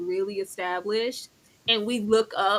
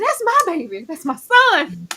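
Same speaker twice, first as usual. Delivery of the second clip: high, slow proud mama cheer; a mother shouting pride at graduation; 7 semitones higher, 5 dB louder than usual. Retold or imitated.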